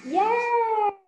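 high, bright burst of praise; teacher cheering a pupil's right answer, excited. Real, not acted.

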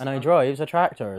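West Country tractor driver; West Country accent. Shown as a demonstration. Modelling the line for a friend, West Country accent. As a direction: burr it, West Country style. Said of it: "Southern England".

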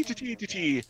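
ordinary talk, nothing marked

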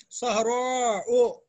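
सहरा ओ stretched slowly with the final ओ held; drawn-out demo. Shown as a demonstration. Teacher drawing out the ending for students, slowly. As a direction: spell out the alif mamduda slowly. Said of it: "अलिफ के बाद हमजा भी है".

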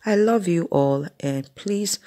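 normal talking voice

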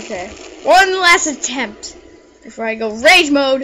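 ordinary talk

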